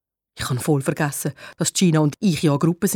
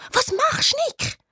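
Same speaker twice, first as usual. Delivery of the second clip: hissed question. A narrator's reading. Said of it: "zischt mich an".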